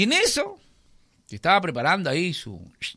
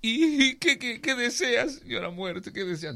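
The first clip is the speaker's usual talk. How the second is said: high, pleading farmer voice; nervous farmer; 11 semitones higher, 4 dB quieter than usual. Acted. Frightened farmer asks Death what she wants, high and timid. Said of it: "le dice".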